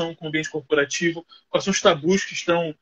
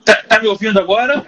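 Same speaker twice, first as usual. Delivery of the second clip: louder, spelling it out over a bad line; to someone hard of hearing; 2 semitones higher, 6 dB louder than usual. Real, not acted. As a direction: raise your voice so they hear. Guest can't hear the host.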